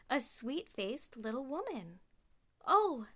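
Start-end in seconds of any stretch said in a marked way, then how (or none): 2.6-3.1 higher and louder; startled oh. Acted. Cinderella meeting the fairy godmother, startled. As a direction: caught by surprise.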